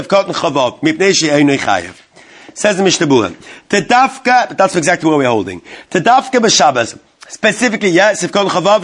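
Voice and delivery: ordinary talk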